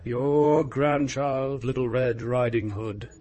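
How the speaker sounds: lightly disguised, slow, drawn-out voice; counterfeited girl's voice. A narrator's reading. Wolf posing as grandchild at the door, mildly counterfeit voice. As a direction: draw it out as a mild imitation of the child. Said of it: "counterfeiting her voice".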